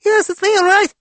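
squeaky, loud pretend critter; very high puppet-like voice; high-pitched ant voice. A narrator's reading. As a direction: squeak it as a tiny talking ant. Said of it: "says in a high-pitched voice".

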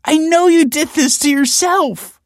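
high, slow, tearful accusation; weeping. A narrator's reading. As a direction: daughter confronting her father in tears. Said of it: "wept Olive".

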